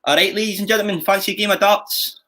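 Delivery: high-pitched Scottish patter; Scottish accent. Shown as a demonstration. Games host calling players to darts, Scottish accent. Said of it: "That's Scottish".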